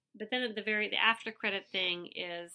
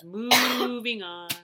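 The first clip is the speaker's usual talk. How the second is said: slow, loud, deadpan drama; slowly and loudly, like a TV hunk; dramatic Peter; 3 semitones higher, 12 dB louder, much slower than usual. Retold or imitated. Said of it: "And Peter says".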